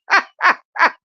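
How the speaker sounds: loud high burst of laughter; high giggle. Real, not acted. High-giggling at her viewers' comments. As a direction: cackle up high.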